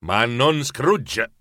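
usual speaking voice

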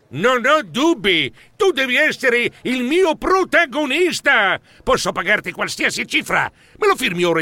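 excited exclamation. Acted. Film producer grabbing his new star, eager exclamation. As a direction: pounce on him with delight. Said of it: "una voce alle mie spalle esclamò".

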